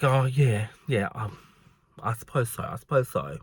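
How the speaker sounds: very soft, low reluctant mumble; grudging concession. Retold or imitated. Customer conceding to shop worker, grudging and quiet. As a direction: imitate someone backing down, voice dropped low and small.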